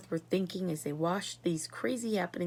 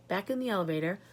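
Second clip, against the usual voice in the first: ordinary delivery, nothing marked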